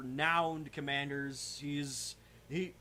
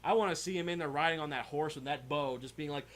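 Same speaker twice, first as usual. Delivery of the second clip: ordinary talk, nothing marked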